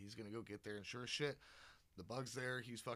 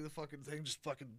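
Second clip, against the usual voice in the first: ordinary delivery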